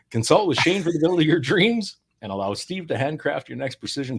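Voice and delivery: upbeat sponsor announcer voice; ad read. Acted. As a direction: sell it like a radio ad.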